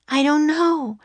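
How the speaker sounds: put-on high-pitched girl's reply; young girl's voice. A narrator's reading. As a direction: voice it as a little sister, pitched high. Annie's voice beside Jack's.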